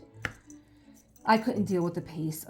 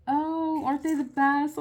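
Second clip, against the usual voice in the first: gushing dog owner; 10 semitones higher, 7 dB louder than usual. Retold or imitated. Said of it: "the person that told them the leash say".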